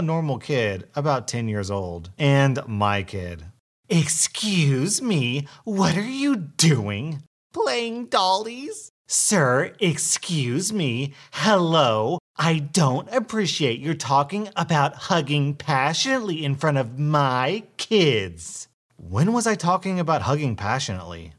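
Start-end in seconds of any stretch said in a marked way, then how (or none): 3.9-7.2 high, slow and indignant; a prim, scandalized busybody; entitled mother voice. Acted. Entitled mother confronts narrator. 7.5-8.9 put-on high little-girl voice, slow; toddler voice. Toddler answers the woman. 9.1-18.7 a prim, scandalized scold; indignant entitled mother. Acted.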